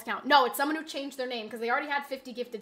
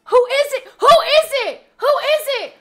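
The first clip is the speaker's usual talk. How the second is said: high, loud repeated demand; high frantic yelling; frantic shout; 10 semitones higher, 12 dB louder than usual. Real, not acted. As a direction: yell out, desperate to know. Demands the gifter's identity.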